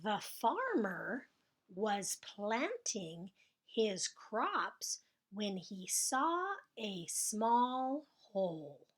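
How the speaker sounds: each word spaced out slowly for writing; slow dictation. Shown as a demonstration. Teacher dictating the sentence to the class, slow and clear. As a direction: read it slowly so kids can write. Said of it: "Boy, that's a long sentence".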